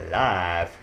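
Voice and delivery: ordinary talk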